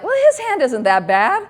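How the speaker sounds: mock pained, laughing reassurance to herself; reluctant self-persuasion. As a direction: talk yourself into liking his hand, wincing through a laugh. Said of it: "and I'd just be like".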